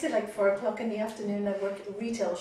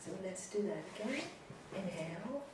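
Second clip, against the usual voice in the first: soft spa whisper; 11 dB quieter than usual. Acted. Guided breathing continues.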